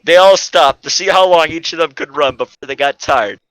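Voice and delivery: ordinary delivery, nothing marked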